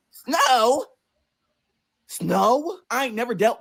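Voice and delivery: high, rising, stunned echo; incredulous question. Retold or imitated. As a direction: repeat it upward in disbelief. Reaction to snow forecast.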